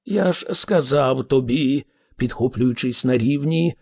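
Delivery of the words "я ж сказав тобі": ordinary delivery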